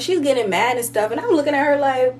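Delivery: ordinary talk, nothing marked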